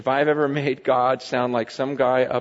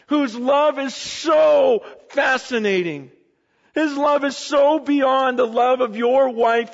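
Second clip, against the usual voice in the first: higher, louder preaching build; preaching at full force; 9 semitones higher, 4 dB louder than usual. Acted. Pastor lifts the sermon to its peak, fervent preaching. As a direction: drive it home from the pulpit.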